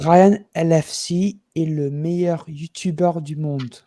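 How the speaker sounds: slow, careful French, each word laid out; same French line, deliberately slowed. Shown as a demonstration. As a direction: say it again slowly for the listener. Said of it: "Slow it, slow, slow".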